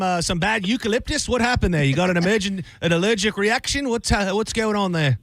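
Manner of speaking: high, cartoonish Australian boss asking questions; Australian accent. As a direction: quiz the sick worker in hammed-up Australian. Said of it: "I was thinking Australian".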